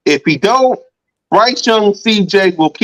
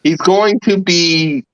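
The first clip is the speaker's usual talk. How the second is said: slow suspense; slower than usual. Real, not acted. Building suspense before the news.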